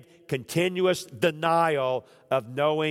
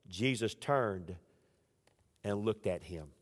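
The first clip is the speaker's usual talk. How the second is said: hushed solemn reading; 6 semitones lower, 8 dB quieter than usual. Acted. Solemn quote of Luke.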